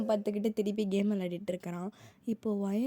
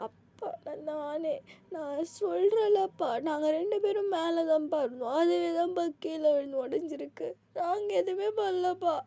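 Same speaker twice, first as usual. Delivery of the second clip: tearful plea; 6 semitones higher than usual. Acted. Crying daughter explains to dad.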